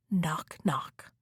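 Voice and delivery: hushed whispered knocking. A narrator's reading. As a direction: whisper it softly, a quiet tap at the door. Knocking at grandmother's door.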